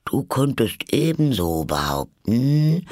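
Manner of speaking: slow, swooping dormouse drawl; talking in sleep. Acted. The Dormouse breaks into the argument, sleepy and drawn-out. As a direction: drag the words out as if asleep. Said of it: "das im Schlafe zu sprechen schien".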